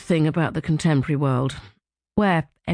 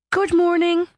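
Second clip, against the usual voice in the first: bright cheerful greeting; 13 semitones higher, 4 dB louder than usual. A narrator's reading. Louise cheerfully greets narrator.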